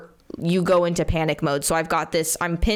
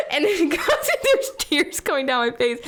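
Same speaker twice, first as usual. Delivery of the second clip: giggling through the words; laughing; 8 semitones higher than usual. Real, not acted. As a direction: laugh while you tell it. Laughing while retelling embarrassing moment.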